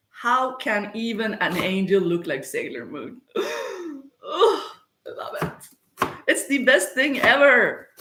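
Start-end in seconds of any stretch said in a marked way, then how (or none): 3.4-7.9 high gasp then gushing praise; delighted gush. Real, not acted. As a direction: swoon over it like a fan. Gushing over Sailor Moon.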